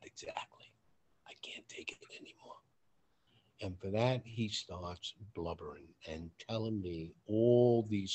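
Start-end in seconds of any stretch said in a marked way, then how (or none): 0.0-2.6 breathless, broken whisper. Retold or imitated. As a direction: confess it in a hushed whisper, defeated. Broken friend's quiet confession.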